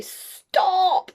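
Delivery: high, loud, drawn-out shout. A narrator's reading. Winnie to her broomstick floating away, shouting. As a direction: shout after something getting away. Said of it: "Winnie shouted".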